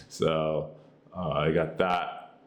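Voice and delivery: ordinary delivery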